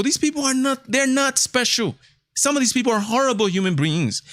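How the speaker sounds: rising indignation, voice climbing; indignant outburst. Real, not acted. Vlogger ranting that athletes are no heroes, indignant and high.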